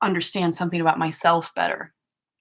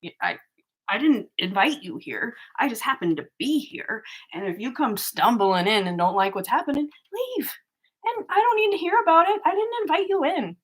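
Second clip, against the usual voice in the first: rising exasperated comeback, much higher by the end; imagined retort; 6 semitones higher than usual. Retold or imitated. A vlogger tells off the trolls in her head, exasperated and ever higher. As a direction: tell them off, climbing higher and higher. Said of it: "And I'm like".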